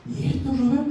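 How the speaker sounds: a woman stunned by her own Bible; mock astonished woman's voice; astonished lady.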